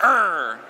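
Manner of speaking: a short loud grunted err; growl face demo. Shown as a demonstration. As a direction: growl like you're straining. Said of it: "Show me your Gur face".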